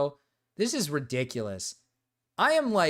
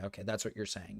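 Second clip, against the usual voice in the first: quiet aside; 8 semitones lower, 11 dB quieter, faster than usual. Real, not acted.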